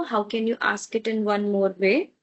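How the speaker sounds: ordinary talk, nothing marked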